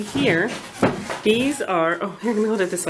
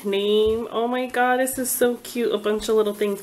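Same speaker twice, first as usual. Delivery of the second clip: normal talking voice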